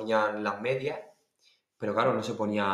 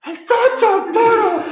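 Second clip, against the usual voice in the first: very high, drawn-out yell; escalating strained calls, imitating his father; father calling out; 7 dB louder, much slower than usual. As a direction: shout the name urgently across a stage. Acts out father calling the dancer.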